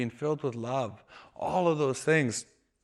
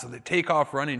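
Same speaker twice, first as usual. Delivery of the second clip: normal talking voice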